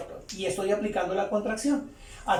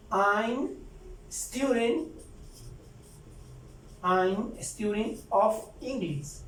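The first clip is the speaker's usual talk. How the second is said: slow, careful English model line; teacher modelling the contraction, slow; slow model sentence; 4 dB quieter, much slower than usual. Shown as a demonstration. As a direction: spell it out slowly for the class. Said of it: "Ahí estoy aplicando la contracción".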